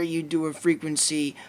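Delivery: ordinary talk, nothing marked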